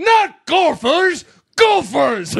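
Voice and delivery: high, shouted mock-Scottish correction; thick Scottish accent. Groundskeeper correcting a misunderstanding in a movie retelling, mock-Scottish shout. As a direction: bellow it like an exasperated Scottish groundskeeper. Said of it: "a very thick, brook Scottish accent".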